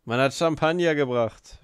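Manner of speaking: loud, excited announcement; shouting. A narrator's reading. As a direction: shout out the news. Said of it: "schrie Rakitin".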